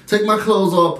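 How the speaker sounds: usual speaking voice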